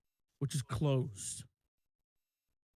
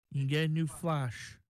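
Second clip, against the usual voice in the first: usual speaking voice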